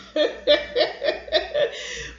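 high, breathy run of chuckles; acted chuckling laugh. Grandfather amused by grandson's dodge, chuckling.

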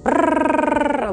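quick rolling brrr, high-pitched; fast brrr noise. Retold or imitated. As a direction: rattle it off like an engine. Automatic pilot, words just flowing.

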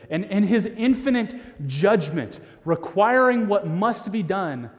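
ordinary talk, nothing marked

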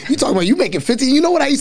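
fast, high mocking mom; imitating his mom. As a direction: rattle it off like a scolding mother. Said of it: "she's like".

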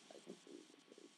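whispered echo, fast. Real, not acted. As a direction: breathe it out, quick and low. Echoing own words under breath.

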